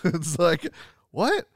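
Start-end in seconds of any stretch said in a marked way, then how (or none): 1.1-1.5 high, baffled disbelief; incredulous. Real, not acted. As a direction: question it in disbelief.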